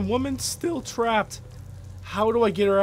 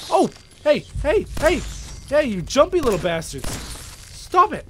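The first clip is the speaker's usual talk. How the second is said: high-pitched, flustered yelling; flustered, voice leaping up; alarmed scolding; 5 semitones higher than usual. Real, not acted. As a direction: shoo jumping pests away in a panic. Yelling at jumping rad roaches.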